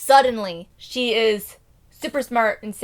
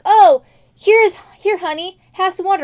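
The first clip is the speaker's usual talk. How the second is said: high, loud sweet offering; mock eager offer; 9 semitones higher, 10 dB louder than usual. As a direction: gush it to please him.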